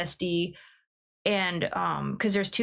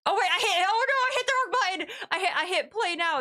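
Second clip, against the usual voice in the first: alarmed; 10 semitones higher than usual. Real, not acted. Hits wrong button, panics.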